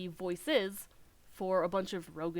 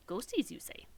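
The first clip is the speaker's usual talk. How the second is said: breathy spooky whisper; 4 semitones higher than usual. Acted. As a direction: hush it like a ghost story. Spooky whispered NPC line.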